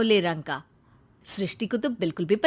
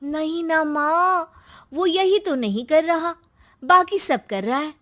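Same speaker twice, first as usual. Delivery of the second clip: scheming tattling; 5 semitones higher than usual. Acted. Daughter telling mother about brother's married love, tattling. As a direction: stretch out the scandal for Mom.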